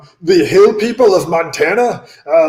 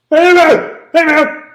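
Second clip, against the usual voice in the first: calling out; 8 semitones higher, 4 dB louder than usual. Acted. Calling out to a friend.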